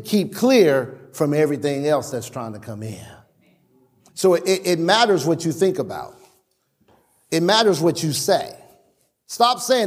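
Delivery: ordinary talk, nothing marked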